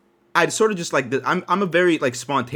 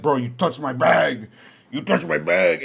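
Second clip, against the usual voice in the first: hammed-up grievance, leaning hard on "bag"; mock angry complainer; 2 semitones higher, 4 dB louder, slower than usual. Angry customer accusing him over a bag, mock-aggrieved. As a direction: imitate an accuser, drawing out the last word.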